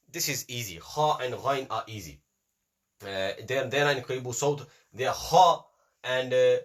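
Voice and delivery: ordinary talk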